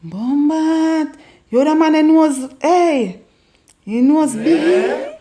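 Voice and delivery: loud, high, laughing Jamaican patois mockery; loud patois tease. Shown as a demonstration. Roasting a man's big nose in loud laughing Jamaican patois. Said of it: "the next word now is nose".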